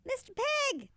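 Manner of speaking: high sing-song call. Acted. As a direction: call out loudly to the pig. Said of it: "I called".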